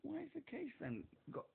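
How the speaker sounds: muffled, far-off phone voice. Real, not acted. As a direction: voice blocked by the phone. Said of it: "you've gone muffled again".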